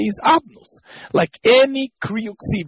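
normal talking voice

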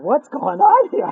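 baffled, everything going wrong; bewildered. Acted. Man bewildered.

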